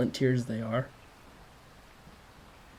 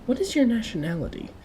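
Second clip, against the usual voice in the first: nosy businessman; 10 semitones higher, 3 dB louder than usual. Acted. Stout businessman questions narrator.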